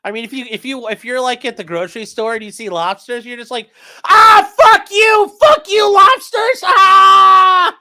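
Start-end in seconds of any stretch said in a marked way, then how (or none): 4.1-7.8 very high, very loud mock rage; hammed-up enraged scream. Shopper seeing lobsters at the grocery store, hammed-up screaming rage. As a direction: scream hatred in absurd overblown fury. Acting out absurd hatred of lobsters.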